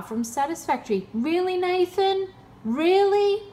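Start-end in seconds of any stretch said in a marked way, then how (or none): 1.1-2.3 high, sarcastic "Really, Nathan?"; mock disbelief. Real, not acted. Taunting the scam recovery agent, mock disbelief. 2.6-3.4 very high, loud squeal on "Really?"; louder mock disbelief. Real, not acted. Pushing the sarcasm at the agent, louder squealed disbelief. Mocking recovery agent's claim.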